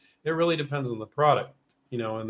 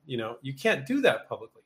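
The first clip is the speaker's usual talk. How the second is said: ordinary talk, nothing marked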